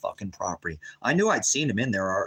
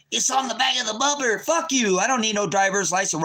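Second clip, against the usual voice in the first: much higher, louder put-on voice, sneering; mock defiant driver; 11 semitones higher, 3 dB louder than usual. Streamer voicing the pulled-over driver to the cop, high mocking defiance. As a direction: squeal back like a cocky driver.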